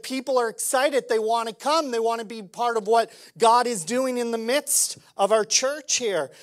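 ordinary talk

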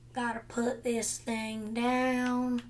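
slow, drawn-out words; dragging the words out; slow odd delivery. Real, not acted. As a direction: drag each word out lazily. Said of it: "why am I talking like this?".

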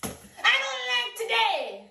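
slow, high, drawn-out whine after a sigh. Acted. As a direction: sigh and sulk. Catchphrase with sigh.

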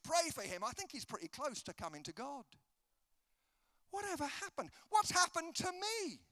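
wistful complaint building to an outburst; plaintive lament. Acted. Preacher mourning his old evangelising zeal, plaintive lament.

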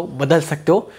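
ordinary talk

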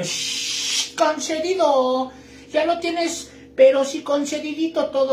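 hissed shh then a high concedido; light snake genie granting wishes; talking snake. Acted. Snake genie granting the wish, hissing then chirpy.

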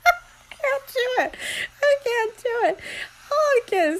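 giving up between laughs; helpless laughter. Real, not acted. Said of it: "It's making me laugh".

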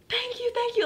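very high gushing thank-yous; squeaky gushing thanks. Retold or imitated. Grateful girl thanks Mari for the money, very high gushing. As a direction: gush your thanks way up high.

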